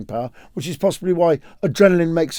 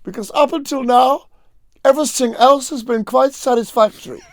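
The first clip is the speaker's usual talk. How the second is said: higher, deadpan mock German boy; dry German kid, higher; Albert's punchline; 7 semitones higher than usual. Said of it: "He just goes".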